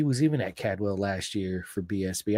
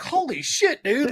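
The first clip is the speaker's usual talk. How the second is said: surprised; 14 semitones higher, slower than usual. Retold or imitated. Rider's reply retold.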